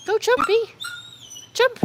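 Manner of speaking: high, loud egging-on; calling out. Real, not acted. Owner urges her dog to jump, excited call. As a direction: cheer the dog on in short bursts.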